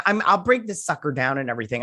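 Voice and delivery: ordinary talk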